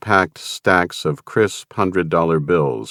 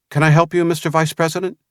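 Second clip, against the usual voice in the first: put-on young agent; 11 semitones higher, faster than usual. A narrator's reading. Agent voiced by narrator.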